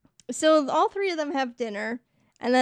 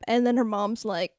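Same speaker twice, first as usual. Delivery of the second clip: usual speaking voice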